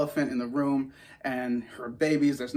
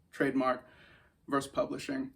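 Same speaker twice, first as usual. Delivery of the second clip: normal talking voice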